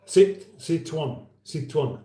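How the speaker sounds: Citroen as "seet-wan", French vowels; French accent. Shown as a demonstration. Host shows it doesn't work at all, French accent. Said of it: "doing a French accent".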